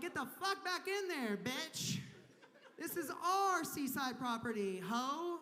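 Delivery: high comic mock yell; mock yelling at waves. Comic yelling at waves on the beach, mock angry and high. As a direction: scold the ocean with a laugh. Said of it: "I'm like".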